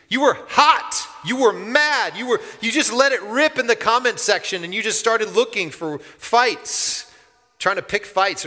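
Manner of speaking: high, loud heated ranting; hammed-up angry outburst; mock online rage. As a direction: play the furious commenter. Preacher acting out online rage.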